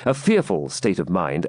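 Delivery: ordinary delivery, nothing marked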